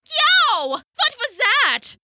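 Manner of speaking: high yelp into an indignant question; startled snap. Acted. Haddo bitten on the toe in bed, startled snap. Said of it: "he snapped".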